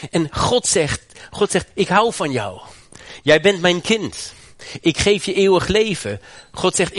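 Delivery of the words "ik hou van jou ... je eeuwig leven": voice of God. Acted. Preacher voices God's loving words.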